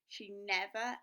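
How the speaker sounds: ordinary delivery, nothing marked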